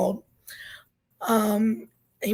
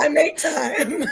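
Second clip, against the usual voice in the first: laughing; 5 semitones higher, 4 dB louder, slower than usual. Real, not acted. Laughing at the sleep question.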